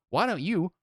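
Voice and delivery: half-hearted retold yell; mock angry comeback. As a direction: hurl it back in mock fury. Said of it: "we scream at each other".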